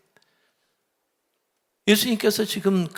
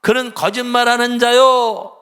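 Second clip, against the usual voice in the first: loud, higher, slow condemnation; stern loud rebuke; 4 semitones higher, 9 dB louder than usual. Retold or imitated. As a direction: pronounce judgment on the liars. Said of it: "그들을 향해서 얘기하는 거죠". (크게 천천히 꾸짖으며)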